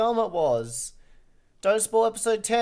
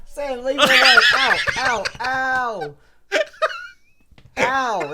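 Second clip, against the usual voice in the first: laughing protest; 5 semitones higher, 6 dB louder, slower than usual. Real, not acted. Friend punching him offscreen.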